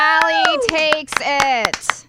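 slow, loud, stretched announcer call; sports announcer. Acted. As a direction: draw it out like a ring announcer. Calls the win like announcer.

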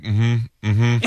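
wary murmur. Retold or imitated. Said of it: "I'm like".

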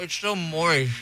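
talking through a mouthful; words around a big bite; mouth full. Real, not acted. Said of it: "I feel like a chipmunk".

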